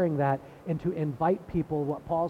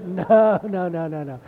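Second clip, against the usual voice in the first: emphatic denial; 2 semitones higher, 9 dB louder, slower than usual. Retold or imitated. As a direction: shout down the idea, laughing it off. Friend firmly denies it.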